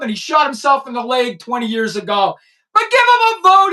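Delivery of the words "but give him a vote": loud, high, hammed-up endorsement; mock excited plug. Real, not acted. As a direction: sell it with mock enthusiasm.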